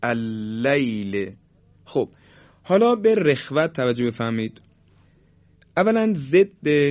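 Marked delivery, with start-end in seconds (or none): 0.0-1.4 slow اللیل with long continuous lams; اللیل with stretched flowing lams. Shown as a demonstration. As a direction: draw out the lam of اللیل smoothly.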